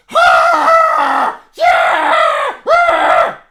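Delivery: high, loud wordless shout; imitated angry yell. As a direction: bellow it like an enraged caller. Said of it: "He sounded like that".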